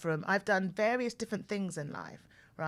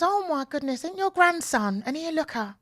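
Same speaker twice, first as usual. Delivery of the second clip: lightly voiced old lady, a bit higher and louder; old lady gushing; 7 semitones higher, 5 dB louder than usual. Old lady on the bus mistakes her son for a grandson, light gushing voice. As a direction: gush like a kindly old lady. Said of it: "She goes".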